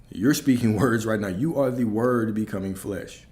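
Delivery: ordinary talk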